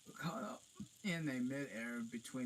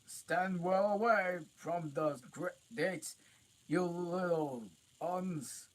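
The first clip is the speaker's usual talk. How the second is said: booming high giant voice, slow; 10 semitones higher, 12 dB louder, slower than usual. A narrator's reading. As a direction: bellow it out like a big giant. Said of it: "said the giant".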